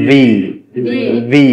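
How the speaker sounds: "ভি" with a buzzing lip; vibrating V. Shown as a demonstration. Teacher demonstrating V, "ভি" with lip against teeth, vibrating. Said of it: "ভিটাতে একটু vibrate হবে ঠোঁট".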